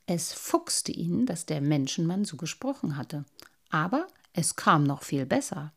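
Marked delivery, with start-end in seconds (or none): none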